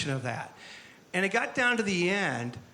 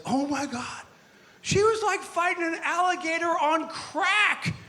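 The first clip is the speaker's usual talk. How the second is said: much higher, amazed guy voice; Henry in awe; 10 semitones higher than usual. Retold or imitated. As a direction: marvel at how hard she fought. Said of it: "Henry says".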